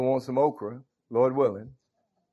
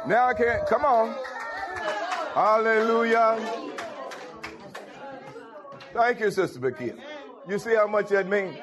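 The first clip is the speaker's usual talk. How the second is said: full-force preaching; 7 semitones higher than usual. Acted. Pastor urging the congregation to respond, preaching hard. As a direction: push the church to answer back.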